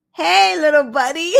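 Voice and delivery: hammed-up politician cooing at a child; mock DeSantis greeting. DeSantis greeting a kid at a campaign stop, mock-chummy and loud. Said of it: "And Ron DeSantis goes".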